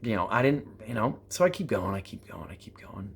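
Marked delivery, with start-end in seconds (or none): 1.9-3.1 fading to a whisper. Real, not acted. Telling the climb story, fading into a whisper. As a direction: soften down until you're whispering.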